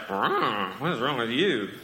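mild mock teen outrage at her son. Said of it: "if she's a junior high girl, she's like".

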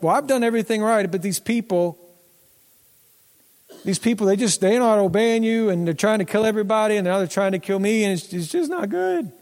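high, fast, whiny mock complaint; pitiful whine. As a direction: whine like a sorry excuse-maker. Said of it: "he's just pitiful".

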